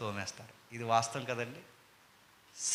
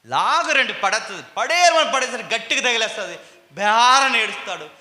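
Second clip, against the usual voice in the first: loud, higher preacher's cry; forceful preaching; 8 semitones higher, 11 dB louder than usual. Acted. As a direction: drive the story home from the pulpit.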